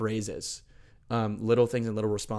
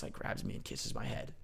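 hushed; 12 dB quieter than usual. Retold or imitated. Tender vision of Jesus told.

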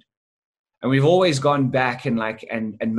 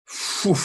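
breathy phew; 7 semitones higher than usual. Retold or imitated. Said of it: "And I was like".

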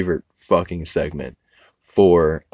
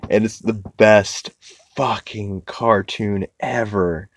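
usual speaking voice

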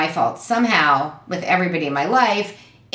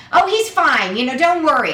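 high breezy mock mom; imitating mom; 8 semitones higher, 3 dB louder than usual. Daughter voicing mom shrugging off worry. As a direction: wave it off lightly.

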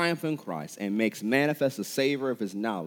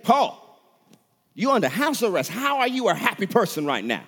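higher, louder challenge to Paul; acted incredulous questioning, pitched up; incredulous questioner; 10 semitones higher, 6 dB louder than usual. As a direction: put the question to him in loud disbelief.

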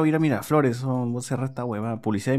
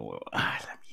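cursing under breath; 11 dB quieter than usual. Real, not acted. Whispered curse at ad.

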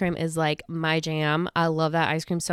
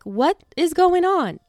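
baffled; 10 semitones higher, 4 dB louder, slower than usual. Retold or imitated. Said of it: "I was just like".

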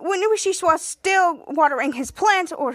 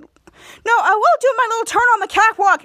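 higher, louder insistent protest; acted defiance; 6 semitones higher, 7 dB louder than usual. Captain Man refuses to stay off the runway, loud defiance. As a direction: insist loudly, pitched up.

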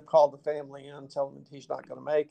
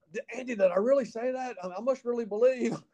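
high, astonished self-questioning; a surprised laugh in the voice as he asks; amazed disbelief; 9 semitones higher than usual. Retold or imitated. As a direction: ask it stunned at what you just said. Amazed at his own words.

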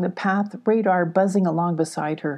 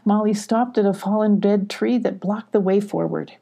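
plain reading voice

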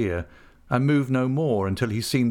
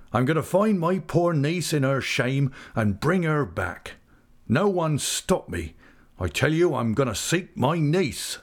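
higher, heavy-hearted seaman insisting he'll go; determined old fisherman; 6 semitones higher than usual. Acted. Old uncle telling Ham he'll search the world, grieving and resolute. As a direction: plead his resolve, half-broken but unshakable. Mr. Peggotty insists, blocking door.

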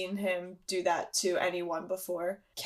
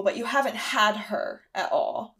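ordinary talk

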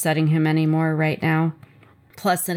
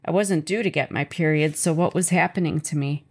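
ordinary delivery, nothing marked

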